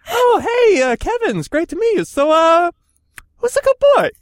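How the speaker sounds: high, loud dog-praising voice; dog talk to people. Pretend. Meeting a new person, talking to him like a good dog. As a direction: greet a stranger like a puppy, pitched high. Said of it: "It's just like".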